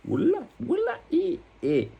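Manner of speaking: high squeaky squeal. Acted. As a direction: squeal it in a tiny voice.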